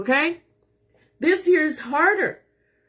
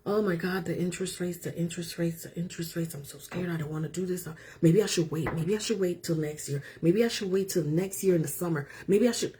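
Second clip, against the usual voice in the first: much softer, lower mock fretting; imitating scared client; 6 semitones lower, 7 dB quieter, faster than usual. Realtor mimics her worried client, soft mock-anxious mumble. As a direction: mimic a nervous buyer under breath. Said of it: "she was just like".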